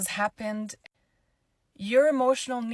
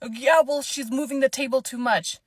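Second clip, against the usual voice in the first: louder, higher, petulant complaint; imitating a snippy lady; 5 semitones higher, 7 dB louder than usual. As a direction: mimic the rude passenger whining at her. Rude lady insists on plane.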